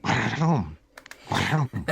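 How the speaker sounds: "Ba-dum" with a rolled, trilled tongue. Shown as a demonstration. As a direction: trill into "Ba-dum" in mock accent. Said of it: "See how I rolled my tongue?".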